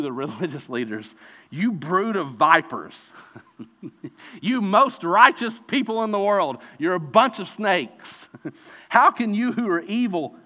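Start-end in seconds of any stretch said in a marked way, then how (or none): 1.5-10.4 raised, amused rebuke of the hypocrites; hammed-up preacher scolding snakes; mock rebuke. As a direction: scold them half-laughing. Jesus rebuking the religious leaders.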